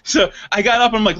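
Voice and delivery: normal talking voice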